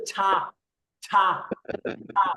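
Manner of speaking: "ছা" with a strong puff of air; aspirated consonant. Shown as a demonstration. Teacher models the Korean sound for students, aspirated "ছা". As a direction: push extra breath out on the "ছা". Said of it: "বাতাসের চাপটা বেশি দিয়ে উচ্চারণ করতে হবে".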